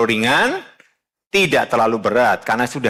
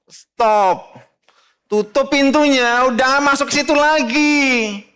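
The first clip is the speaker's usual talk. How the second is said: high, loud, urgent preacher's exhortation; fiery preaching; 8 semitones higher, 4 dB louder, slower than usual. Real, not acted. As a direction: drive it home from the pulpit. Preaching at full force. (khotbah berapi-api)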